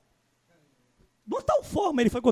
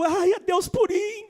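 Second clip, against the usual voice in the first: mock tearful; 7 semitones higher, slower than usual. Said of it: "Tirou lágrima dos meus olhos".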